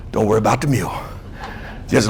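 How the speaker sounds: exhausted murmur. Acted. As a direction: barely get the words out, drained. Exhausted after the heavy lift.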